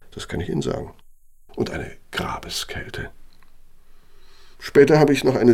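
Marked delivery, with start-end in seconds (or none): 1.5-3.1 hushed, slow unvoiced whisper; slow eerie whisper about the cold. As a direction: breathe it like a ghost story. Old architect recalling Siberian cold.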